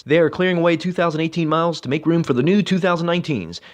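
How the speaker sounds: high-pitched, driving car-sale pitch; car ad read. Acted.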